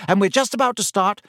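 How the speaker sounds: hurried and eager; quick eager schoolgirl. A narrator's reading. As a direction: rush it out keenly. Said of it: "said Hermione quickly".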